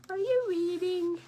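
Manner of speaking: high, loud eager goose question; nosy goose. Acted. Goose butting in on bear, high eager question. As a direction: pop the question up high and bright.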